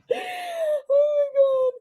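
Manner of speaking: very slow, high wail of laughter; real laughing cry. Real, not acted. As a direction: stretch it out, nearly crying from laughing. Laughing fit over screenshot.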